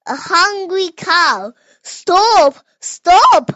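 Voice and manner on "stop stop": cow crying out. A narrator's reading. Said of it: "cried the cow".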